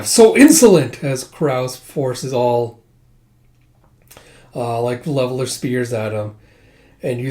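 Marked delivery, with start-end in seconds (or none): 0.0-1.0 high, loud mock outrage; acted indignation, pitched well up; indignant outcry. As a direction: cry out as an affronted soldier. Retold enemy forces outraged at insolence.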